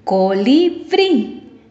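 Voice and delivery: "Colibrí" drawn out, final BRÍ stressed; slow syllables, landing on BRÍ in "Colibrí"; stress on last syllable. Shown as a demonstration. Teacher repeating for pupils, "Colibrí" stressed on BRÍ. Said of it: "en la última sílaba".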